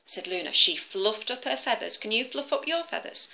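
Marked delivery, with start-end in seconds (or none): none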